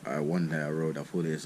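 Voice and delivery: ordinary talk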